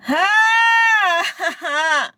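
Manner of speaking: long, high, loud wail; exhausted wail. Real, not acted. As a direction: cry out after a brutal workout. Drained moan after gym workout.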